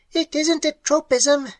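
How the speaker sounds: shrill and precise, clicking; shrill clicking creature. A narrator's reading. Creature answering Ham, shrill clicking voice. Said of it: "clicked in shrill but perfect English".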